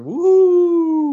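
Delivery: high, loud cheer; excited woo. Real, not acted. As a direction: whoop it up for the crowd. Cheering show opening.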